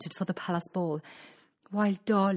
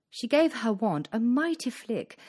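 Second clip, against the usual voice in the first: ordinary delivery, nothing marked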